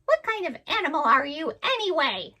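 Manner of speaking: a nosy bird squawking its question; parrot voice. Acted. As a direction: parrot asking Suki what animal he is, high and squawky.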